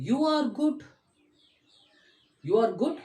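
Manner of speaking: good rising at the end; "You are good?" with GOOD rising; questioning tone. Shown as a demonstration. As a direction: ask it doubtfully, voice up. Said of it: "I read the statement like a question".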